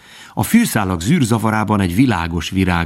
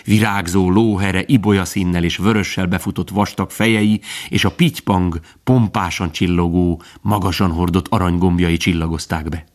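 usual speaking voice

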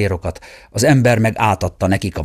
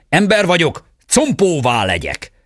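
exclamation; 8 semitones higher than usual. A narrator's reading. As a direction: bellow the magic words in pursuit. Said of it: "A sárkány király is fölkiáltott". (magasabb, hangos varázsige)

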